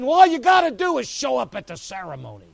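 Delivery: loud and high, climax of pressure; unsaved parents pushing the believer, loud and insistent; urging parents. Acted. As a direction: press it hard.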